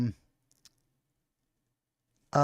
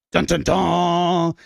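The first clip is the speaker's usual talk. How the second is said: sung dramatic sting; much slower than usual. Pretend. Sung dramatic sting after title.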